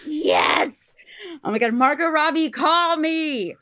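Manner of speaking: high, loud, triumphant call; excited shout. Real, not acted. Calling out to Margot Robbie about the idea, excited shout. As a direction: holler it out, bursting with excitement.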